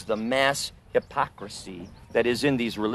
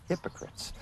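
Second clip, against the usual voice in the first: Hypocrites whispered with no voice; 16 dB quieter than usual. Shown as a demonstration. Repeating the word for effect.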